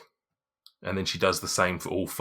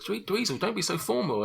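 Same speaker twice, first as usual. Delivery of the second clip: delighted greeting; 13 semitones higher than usual. Acted. Shopkeeper greets old friend warmly.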